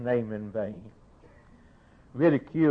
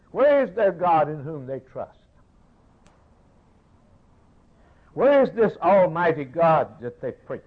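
raised, pleading pulpit cry; fervent preaching; 6 semitones higher, 7 dB louder than usual. Acted. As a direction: thunder it from the pulpit, higher and louder. Preaching at full force.

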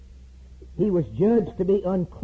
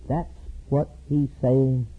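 normal talking voice

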